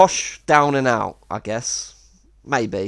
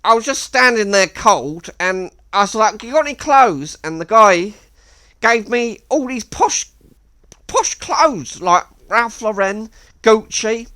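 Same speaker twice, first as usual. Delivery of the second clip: much higher boastful mock voice; imitating Miles; 8 semitones higher, 4 dB louder than usual. Miles bragging about the posh clothes, higher mock voice. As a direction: brag in a higher put-on voice. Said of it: "And then he said".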